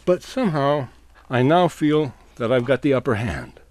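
usual speaking voice

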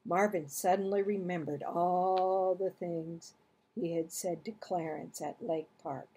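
plain reading voice

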